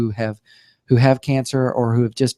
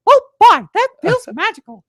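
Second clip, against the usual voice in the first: much higher squeaky cartoon mouse; Mickey Mouse; 7 dB louder than usual. Patient answers the doctor in a squeaky cartoon mouse voice. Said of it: "ask Mickey how he's doing".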